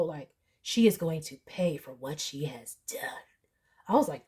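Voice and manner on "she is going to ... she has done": hushed, low, solemn warning; solemn whisper. Retold or imitated. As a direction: whisper it like a grim prophecy. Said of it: "the waitress was so solemn".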